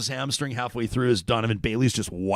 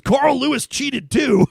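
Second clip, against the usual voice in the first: excited quote; 8 dB louder, slower than usual. Retold or imitated. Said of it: "Curtis chiming in says".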